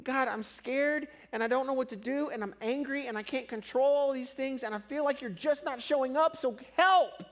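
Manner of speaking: desperate pleading prayer. Retold or imitated. Retold desperate prayer to God.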